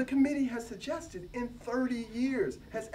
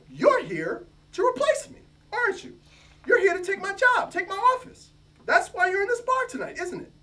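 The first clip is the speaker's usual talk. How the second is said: higher, louder, rising accusations; accusing outburst; 12 semitones higher, 7 dB louder than usual. Acted. Jealous professor accusing the new hire, high and loud. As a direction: fling the suspicion at him, voice up high.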